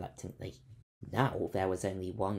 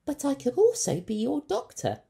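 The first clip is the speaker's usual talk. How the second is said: put-on high, bright young doctor voice, much higher; a keen ship's doctor offering help; Leica's voice; 9 dB louder than usual. A narrator's reading. Ship's doctor to the captain, high and eager.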